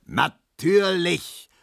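high, drawn-out angry roar; long, exasperated high yell; furious bellow. A narrator's reading. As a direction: roar the word out slowly, stretching it. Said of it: "brüllte Herr Markowski".

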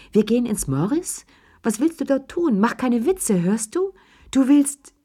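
hoch und drängend, immer höher; aufgeregt ungläubig. Acted. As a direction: fassungslos nachfragen, Stimme steigt. Desmond verliert die Selbstbeherrschung. (Desmond verliert aufgeregt die Fassung)

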